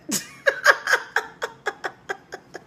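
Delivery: loud, high burst of laughter; real laughter. Real, not acted. Storyteller cracking up at own punchline, real laughter. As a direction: laugh hard and long.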